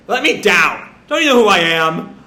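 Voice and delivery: mock-indignant taunt; lightly acted bluster. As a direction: demand it with puffed-up self-importance. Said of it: "And Jet just taunts".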